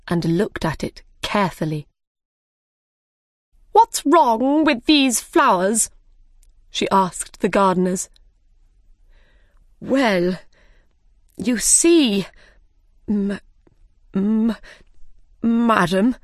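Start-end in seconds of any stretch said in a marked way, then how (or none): 3.7-5.9 high, slow, demanding question; imperious Queen. A narrator's reading. Queen interrogates the gardeners. 9.8-16.2 halting, with long pauses and a stuck M; nervous stammer. A narrator's reading. Scared gardener stammers to Queen.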